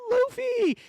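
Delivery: name shot up high; sudden high-pitched gasp of realization; startled realization. Real, not acted. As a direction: blurt the name in shock.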